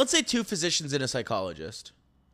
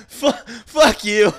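laughing groan; 7 semitones higher, 13 dB louder, much slower than usual. Real, not acted. Groaning at the pun.

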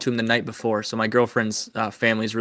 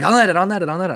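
high, louder frantic repeats; imitated freaking out; 8 semitones higher, 4 dB louder, much faster than usual. Fishing partner fumbling for the net, imitated frantic excitement. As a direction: mimic someone scrambling in a panic of excitement. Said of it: "he's just freaking out".

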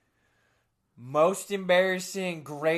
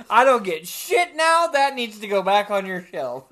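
higher, louder mock demand; mock indignant return; 4 semitones higher, 7 dB louder than usual. Customer returning a sex toy, hammed-up indignant demand. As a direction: insist on the return like a wronged customer. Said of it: "Be like".

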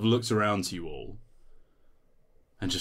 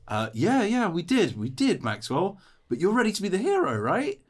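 high-pitched, raised humouring voice; coaxing companion; 12 semitones higher, 6 dB louder than usual. Acted. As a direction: egg the hero on in a high voice. Companion humours and coaxes the dazed hero.

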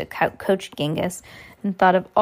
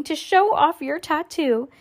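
raised, playful pitch; feigned joking; 8 semitones higher than usual. A narrator's reading. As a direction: finish the pretend joke high. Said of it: "as if he were joking".

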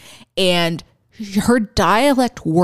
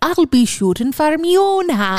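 high, hammed-up Scottish brogue; mock Scottish accent; 8 semitones higher than usual. Quoting a defiant line to co-host, mock Scottish accent. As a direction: pitch it up in a broad Scottish accent. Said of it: "from Mary Queen of Scots".

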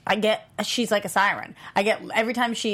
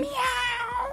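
high playful meow; cat meow; 4 dB quieter than usual. Pretend. Playful cat noise greeting.

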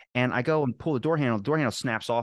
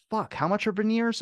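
mock shock; 12 semitones higher than usual. Shock at dental prices.

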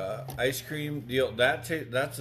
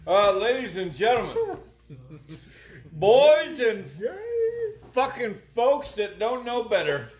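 announcer voice; 8 semitones higher, 5 dB louder than usual. Acted. Podcast host intro announcement.

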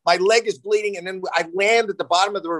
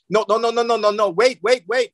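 rising mock panic, higher and pleading; a retold dad's panic, higher pitched; panicked no; 6 semitones higher than usual. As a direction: beg her to hold it, voice climbing. Panicking dad on freeway.

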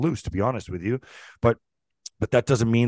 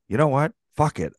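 impulsive decision; 7 semitones higher, 3 dB louder than usual. Retold or imitated. Deciding to go for it.

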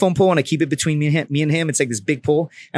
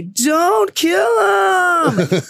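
very high, drawn-out mock wail; imitated girl screaming; much slower than usual. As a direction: mimic a panicked woman crying out. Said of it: "I heard some girl say".

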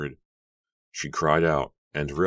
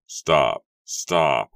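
louder, repeated cries to halt; urgent shouting; 7 dB louder than usual. A narrator's reading. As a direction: shout to stop them. Running to halt the execution.

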